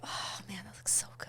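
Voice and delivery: hushed, airy craving whisper; whispered longing. Retold or imitated. Dieting mom eyeing the ice cream, whispered longing. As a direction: whisper it like a secret temptation.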